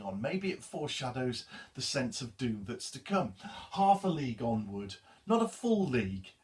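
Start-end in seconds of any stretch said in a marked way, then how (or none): 3.7-6.3 emphatic "not a full league"; stress on half. Shown as a demonstration. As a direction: point out it is only half. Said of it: "The sense there is given that maybe there's some sort of a mistake".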